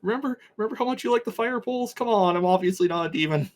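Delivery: high, hurried appeal; urgent pleading. Acted. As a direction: talk fast to save your skin. Peter pleads to be recognized.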